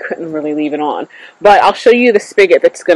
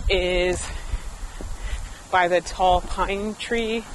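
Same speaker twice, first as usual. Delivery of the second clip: ordinary delivery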